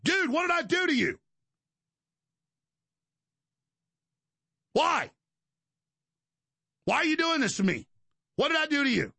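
put-on high, wounded plea to an old friend; pleading, high and hurt. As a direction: beg him in a high, hurt voice. Pleading with Bongino, imagined.

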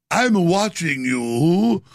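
slow, drawn-out mock British menace; fake British accent. Radio host mocks Gorka in a slow fake British accent. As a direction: put on a fake British accent, watching you. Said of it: "with my fake British accent".

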